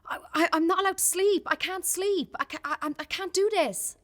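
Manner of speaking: mimicking her half-asleep self in distress; panicked pleading.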